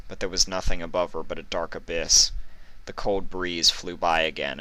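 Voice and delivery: ordinary delivery, nothing marked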